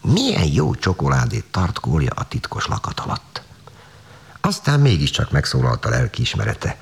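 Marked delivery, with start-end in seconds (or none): 0.0-3.4 hushed whispered thought while licking fingers; thinking aloud, whispered. Acted. Tánya tastes the secret chocolate, whispering her thoughts. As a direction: whisper it to yourself, guilty and pleased. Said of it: "így gondolkodott".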